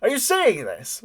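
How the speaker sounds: high, loud excited yell; yelling over his shoulder. Acted. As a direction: holler it back over your shoulder in disbelief. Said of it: "he yells over his shoulder".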